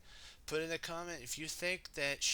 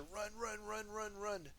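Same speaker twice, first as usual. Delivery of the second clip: acted running; 9 semitones higher, 5 dB quieter than usual. Acts out Kai running.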